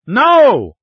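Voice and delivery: very high, very loud cry; screamed refusal. A narrator's reading. Andrea held back from following Ryan, screaming. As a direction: scream it out in protest. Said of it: "Price O'Day screamed".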